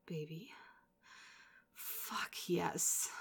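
ordinary talk, nothing marked

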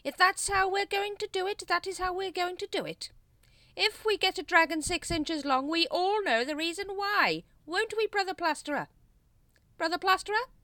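high, loud sarcastic rant; threatening schoolmasterly scolding; exasperated Grand Master. A narrator's reading. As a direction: rant at the culprit, pitch held high. Grand Master rants at Plasterer.

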